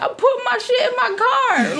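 defensive retort. Retold or imitated. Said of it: "she's like".

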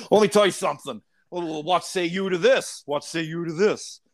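high, blustering wrestler challenge; Jesse the Body Ventura. Pretend. Guest challenging the podcast hosts, high blustery wrestler impression. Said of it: "all-American Jesse the Body Ventura".

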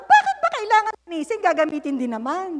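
fast, high whiny mock complaint; grumbling child. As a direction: whine like a lazy kid. (ginagayang reklamo ng bata)

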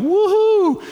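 high, loud mock cheer of a thrill-seeker; mock woohoo. Speaker mocking the brave jump he didn't make, high cheer. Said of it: "I can't say that I jumped in going".

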